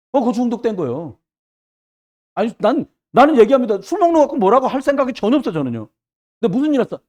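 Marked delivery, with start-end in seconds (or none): none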